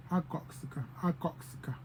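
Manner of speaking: soft "ha goxka" without the H; "ha goxka" said as "a goxka", no H, softly; soft elder dialect form; dropped first H. Shown as a demonstration. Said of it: "They would just say".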